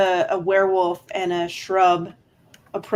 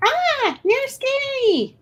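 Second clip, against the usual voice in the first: put-on very high, drawn-out child's wail; scared little kid; slower than usual. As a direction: whine for help like a frightened child. GM voices a frightened child.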